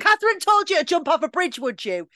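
teasing impression of a scolding mum or dad; mock parent scolding. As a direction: nag like a parent.

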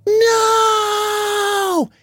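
a high, desperate scream; boy screaming no at animal control. Acted. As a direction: scream it to stop them taking your pet. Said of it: "And Liam screamed".

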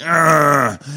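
put-on loud high kid groan; grumpy groan. Son hides under pillow from dad, loud annoyed groan.

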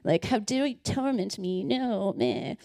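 slow, soft sneering demon; mock the demon's scorn, slowly; lightly voiced contemptuous demon. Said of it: "So I picture his voice like".

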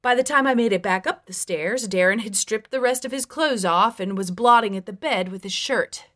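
ordinary delivery

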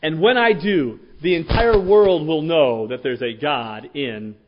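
loud fierce warning; fierce threat. Retold or imitated. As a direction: warn the giant fiercely. David's paraphrased threat.

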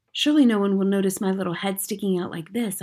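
usual speaking voice

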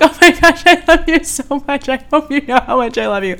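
high, rapid gush of love; gushing affection. Acted. Podcaster showering listeners with compliments, high-pitched gushing. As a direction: pour out adoring praise in a rush.

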